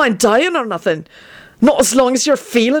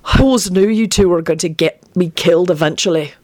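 usual speaking voice

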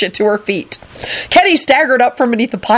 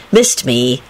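low, smug little taunt; pleased with himself, pitched low; smirking; 7 semitones lower than usual. A narrator's reading. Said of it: "Lupin smirked".

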